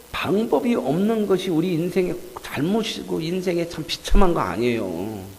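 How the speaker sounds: ordinary talk, nothing marked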